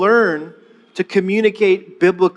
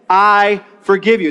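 slow and louder; slow, louder model line; 3 dB louder than usual. Shown as a demonstration. Pastor leading congregation, slow and louder. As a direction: lead them firmly. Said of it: "Say that with me".

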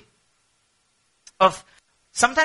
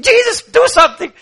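very high, loud demand; desperate cry; 12 dB louder than usual. Acted. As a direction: shout for help impatiently. Acted desperate cry to Jesus.

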